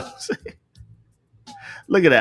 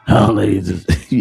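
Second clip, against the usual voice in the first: mock deep man voice; 7 semitones lower than usual. Teasing his co-host about her morning voice, mock low voice. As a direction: voice dropped low like a grown man. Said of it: "y'all be sounding like full grown men".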